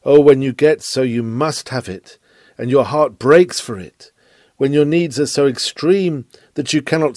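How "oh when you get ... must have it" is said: usual speaking voice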